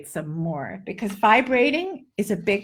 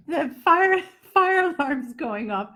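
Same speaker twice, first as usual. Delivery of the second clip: ordinary delivery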